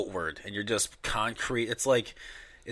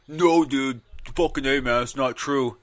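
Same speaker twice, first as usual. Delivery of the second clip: loud whiny mock objection; louder, higher mock protest; 3 semitones higher, 7 dB louder than usual. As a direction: voice the other guy's loud denial. Mock argument voicing other side.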